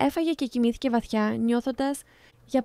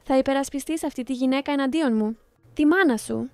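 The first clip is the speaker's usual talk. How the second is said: ordinary delivery, nothing marked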